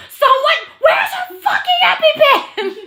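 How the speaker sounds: very high, full-volume yell; slow, high acted screech; freaking-out mom. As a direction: scream for help like a frantic mother. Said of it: "So mom is freaking out".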